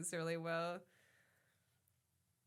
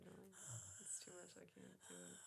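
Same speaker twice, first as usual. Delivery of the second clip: much lower and softer, defeated dog voice; voicing the dog; 3 semitones lower, 18 dB quieter, faster than usual. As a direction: sound overwhelmed, barely audible. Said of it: "She said".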